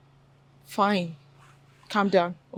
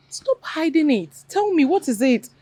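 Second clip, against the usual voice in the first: high-pitched, urgent pressing; exasperated, raised-pitch urging; insistent demand; 7 semitones higher than usual. Acted. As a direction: press hard for a confession. Friend presses her to confess.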